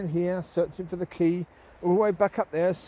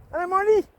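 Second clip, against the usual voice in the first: high, loud hello to the dog; bright sing-song hello, much higher; greeting a dog; 12 semitones higher, 6 dB louder, faster than usual. Real, not acted. Owner greets the dog Molly, high and loud.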